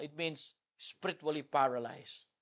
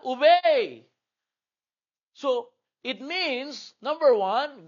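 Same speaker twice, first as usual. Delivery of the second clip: high, loud preacher's charge; preaching at full force; 8 semitones higher, 9 dB louder, slower than usual. Acted. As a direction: thunder the word of God at the kids. (masigasig na pangangaral)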